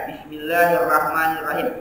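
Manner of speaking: ucapkan Bismillahirrahmanirrahim perlahan dan utuh; bacaan lambat. Shown as a demonstration. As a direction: contoh bacaan yang benar, pelan. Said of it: "Nah, itu yang benar". (Bismillahirrahmanirrahim dibaca pelan)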